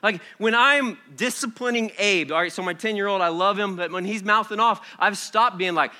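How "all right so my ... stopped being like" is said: usual speaking voice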